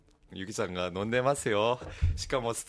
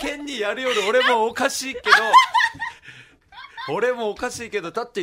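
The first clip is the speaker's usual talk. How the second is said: high laughter; laughing; 11 semitones higher, 9 dB louder than usual. Real, not acted. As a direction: laugh through it.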